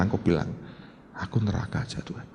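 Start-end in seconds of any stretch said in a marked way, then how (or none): 1.1-2.3 hushed, broken whisper of surrender; a soft low plea to God. Pretend. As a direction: whisper it as if giving up everything. Surrender prayer.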